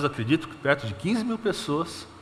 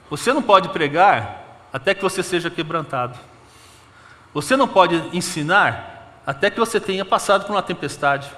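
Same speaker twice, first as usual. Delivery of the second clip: louder, rising preacher's exhortation; fervent preaching; 3 semitones higher, 4 dB louder than usual. Acted. Pastor exhorting the church about being broken, fervent preaching. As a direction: drive the point home to the congregation.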